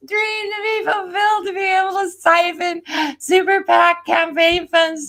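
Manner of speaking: scornful tarot verdict; mocking. Real, not acted. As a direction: sneer as you read her fate. Mocking reading of the card.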